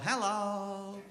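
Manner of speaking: hello pitched well up, lilting; a cheerful high hello to the camera; high sing-song hello. Real, not acted. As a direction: greet the camera brightly up high. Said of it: "we need to say hello".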